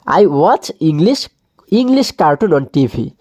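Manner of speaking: very slow, drawn-out English answer; slow model answer. Shown as a demonstration. Teacher modelling the answer for pupils to copy, very slow. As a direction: dictate the answer word by word. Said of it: "teacher models answer slowly".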